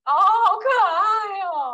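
slow, high, loud gushing coo. Real, not acted. Hearing about the kid's piggy bank, she coos delightedly. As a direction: melt over something adorable.

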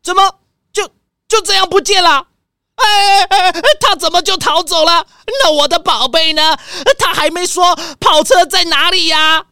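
frantic, he has lost his cars; panicked dismay. Acted. Thief vanishes, owner panics.

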